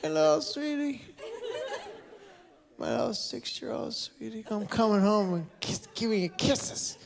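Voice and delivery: put-on high, slow cooing baby talk; sloppy tender drunk dad gushing. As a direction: coo over the phone like a sappy drunk dad.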